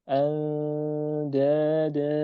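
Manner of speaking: Andada very slow, with a light, thin nasal before the d; thin ikhfa. Shown as a demonstration. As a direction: stretch Andada slowly and keep it light. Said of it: "bukan al". (Andada dibaca tipis dan sangat pelan)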